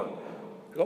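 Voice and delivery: ordinary talk, nothing marked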